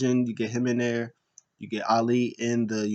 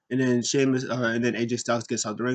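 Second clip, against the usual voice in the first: ordinary talk, nothing marked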